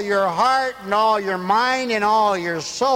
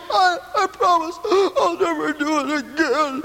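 put-on high, pleading little boy voice; hammed-up child swearing he won't again; mock child promise; 9 semitones higher than usual. Little boy promises his father never again, pleading.

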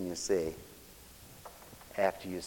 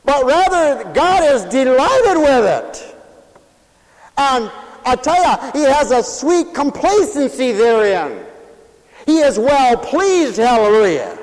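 loud, higher, slow pulpit fire; full-force preaching; 11 semitones higher, 11 dB louder, slower than usual. Acted. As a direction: proclaim it to the whole church.

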